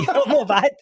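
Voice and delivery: giggling while repeating a backhanded compliment; laughing. Retold or imitated. Laughing while quoting the bouncer.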